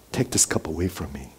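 very soft, low plea; earnest prayer. Acted. Preacher voicing Jesus praying to the Father, earnest hushed plea. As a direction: plead quietly, pitched low. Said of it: "earnestly prayed".

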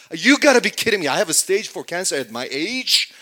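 loud, high-pitched incredulous protest; infuriated. Acted. Pretend patient Kevin protests cancer at his age, infuriated. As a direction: argue back in outrage at the diagnosis. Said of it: "He gets infuriated, mad at me".

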